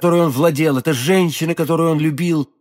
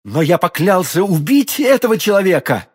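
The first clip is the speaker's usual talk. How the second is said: heated, higher-pitched outburst; vehement exclamation; 5 semitones higher, 4 dB louder than usual. A narrator's reading. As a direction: exclaim it as a sworn vow, voice raised. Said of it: "воскликнул Д'Артаньян". (яростное восклицание)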